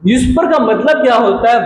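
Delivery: ordinary talk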